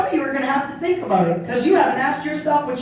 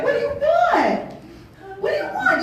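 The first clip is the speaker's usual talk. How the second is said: much higher, half-laughing mock yell at a crying child; exasperated parent; 12 semitones higher than usual. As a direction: play the frazzled parent, laughing as you shout.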